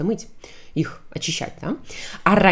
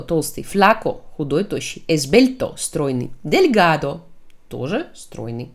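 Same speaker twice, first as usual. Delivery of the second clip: ordinary delivery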